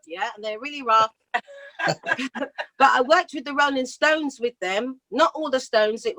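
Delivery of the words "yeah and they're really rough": ordinary talk, nothing marked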